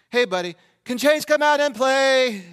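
put-on loud, high, drawn-out kid voice; annoying neighbor kid. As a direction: imitate a neighbor kid calling out, slowly and loudly. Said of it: "why do you have to have a voice like that".

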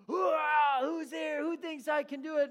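high, loud megaphone hype; hammed-up showman shouting to a crowd. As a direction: pump up the tourists like a showman. Tightrope walker hyping crowd.

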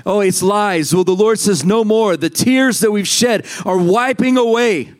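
high, fervent prophetic preaching; prophesying. Real, not acted. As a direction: declare it over the church with urgency. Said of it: "I'm gonna prophesy right now".

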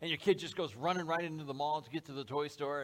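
ordinary delivery

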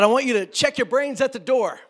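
loud, high preacher's bellow; mock preacher. Old-time preacher ordering the congregation, loud mock preaching. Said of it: "when preachers would say things like".

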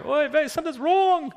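put-on high, worried Yiddish accent. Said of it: "the biblical characters with those accents".

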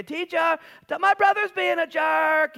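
high, loud, drawn-out plea; whiny shout. Retold or imitated. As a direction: yell it up high like a spoiled kid. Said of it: "And he's like".

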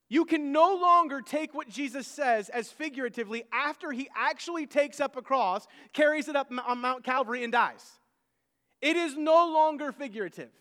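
forceful preaching. Acted. Preacher drives home his point.

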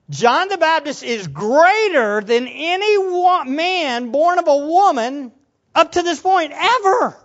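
emphatic amazement. Acted. Preacher paraphrases Jesus ranking John, emphatic amazement. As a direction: proclaim it as an astonishing ranking.

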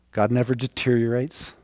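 ordinary talk, nothing marked

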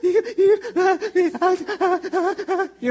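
loud, high gasping sobs, hammed up; imitated breathless sobbing. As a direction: act a kid too worked up to catch a breath. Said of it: "you can't catch your breath".

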